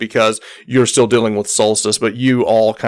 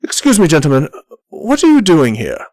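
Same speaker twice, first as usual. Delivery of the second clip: lightly voiced challenger, pitched up; a higher voice for a pointed question; Galma; 5 semitones higher than usual. Galma confronting the visiting captain, lightly voiced challenge. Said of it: "She goes, goes".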